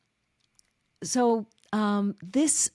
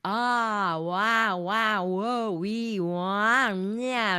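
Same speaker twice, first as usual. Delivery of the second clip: vocal warm-up; much slower than usual. Shown as a demonstration. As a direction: sing out loose warm-up vowels. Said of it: "you can just go".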